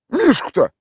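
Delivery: high, put-on village voice; gruff local man answering a stranger; rough villager. A narrator's reading. Tolik answers the city stranger in a rough village voice.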